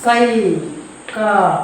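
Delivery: സൈകതം stretched very slowly, each syllable held; slow drawn-out demo. Shown as a demonstration. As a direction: spell out സൈകതം slowly for the class. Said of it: "ഇനി അടുത്തൊന്ന് നോക്കാം". (സൈകതം പതുക്കെ നീട്ടി ഉച്ചരിക്കുക)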